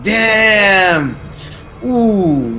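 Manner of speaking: impressed exclamation. Retold or imitated. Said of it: "you go like".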